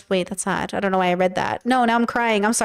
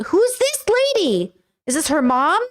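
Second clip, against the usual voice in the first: real bafflement; 6 semitones higher than usual. Real, not acted. Baffled viewer scrolling a stranger's videos, incredulous. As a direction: draw it out high in disbelief.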